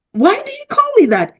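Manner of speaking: high, quick acted query; high-pitched retold bafflement; retold puzzled question.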